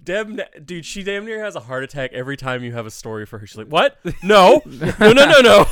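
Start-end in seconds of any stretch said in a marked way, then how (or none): 3.7-5.7 loud, high-pitched mock panic; hammed-up shriek of alarm, climbing higher; alarmed mom. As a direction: shout the refusals like a horrified mother. Mimics mom's alarmed reaction to story.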